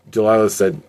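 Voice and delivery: plain reading voice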